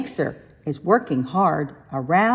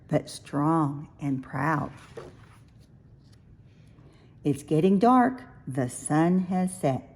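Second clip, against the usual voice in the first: soft bedtime close; 3 semitones lower, 5 dB quieter than usual. A narrator's reading. Reading to a sleepy child, soft bedtime voice. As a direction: lull the child toward sleep.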